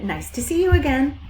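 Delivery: sheep greeting. A narrator's reading. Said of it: "they cried".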